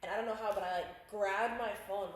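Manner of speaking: ordinary talk, nothing marked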